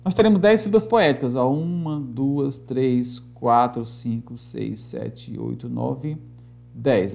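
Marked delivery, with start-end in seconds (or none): 1.5-7.1 low, slow count fading softer; subdued, evenly spaced counting; counting under the breath. Real, not acted. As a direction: tick off each number quietly to yourself. Counts syllables along the board.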